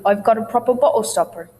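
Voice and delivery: BOTTLE with t caught in the throat; quick "proper bo'le stopper"; glottal stop tongue twister. Shown as a demonstration. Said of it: "Glottal stops include".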